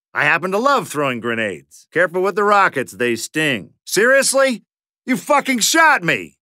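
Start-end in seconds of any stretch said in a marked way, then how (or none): 3.9-6.4 high-pitched indignant shout at a teammate; outraged yell. Real, not acted. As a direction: yelp of betrayal, pitch shooting up. Hit by friendly fire.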